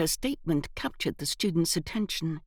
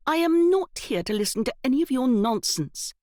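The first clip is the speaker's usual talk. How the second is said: higher, louder sharp retort; snapping; 7 semitones higher, 4 dB louder than usual. A narrator's reading. Evelyn cutting off the boy's nonsense, snapped. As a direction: snap it at him. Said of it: "Evelyn snapped".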